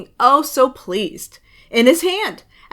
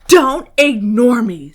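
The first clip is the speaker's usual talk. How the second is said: louder, firm command; insistent demand; 9 dB louder than usual. Acted. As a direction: make him listen. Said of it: "said the crayon".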